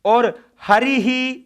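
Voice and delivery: हरिः drawn out slowly with a clear final visarga; teacher slowly sounding out the visarga; slow pronunciation demo. Shown as a demonstration. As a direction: stretch हरिः so the visarga is heard. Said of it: "जिह्वा मूल्य है".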